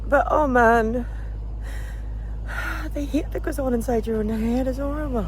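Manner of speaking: high voice, real upset with sighs; sigh, then speak high through emotion; emotional. Real, not acted. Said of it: "gonna get emotional again".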